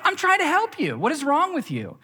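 high exasperated wife, mock outrage. Wife scolding him for refusing help, high mock exasperation. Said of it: "And she's like".

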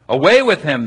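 mild mock yell; crowd yelling. A narrator's reading. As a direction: throw it out like a crowd. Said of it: "they yelled".

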